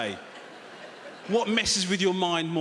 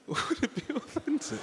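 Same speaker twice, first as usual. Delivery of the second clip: laughing; 8 semitones higher, 5 dB quieter than usual. Real, not acted.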